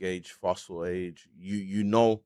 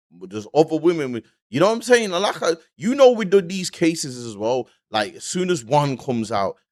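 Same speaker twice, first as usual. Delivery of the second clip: worked up; 6 semitones higher, 8 dB louder than usual. Real, not acted. Worked up about more victims.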